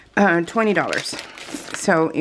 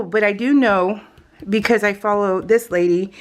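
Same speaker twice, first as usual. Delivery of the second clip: ordinary delivery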